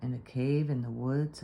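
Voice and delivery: ordinary delivery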